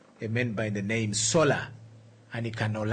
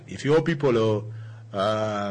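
put-on Nigerian accent; 3 dB louder than usual. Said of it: "trying to get that, that, uh, Nigerian accent".

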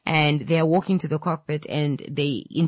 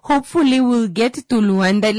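unsure pilot; 7 semitones higher, 7 dB louder than usual. Acted. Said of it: "the person uses words like".

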